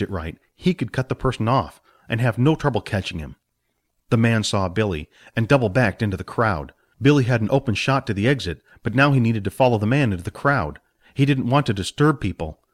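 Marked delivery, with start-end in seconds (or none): none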